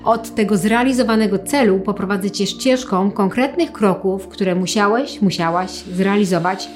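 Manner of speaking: calm them with long pauses. Acted. Leads a guided visualization.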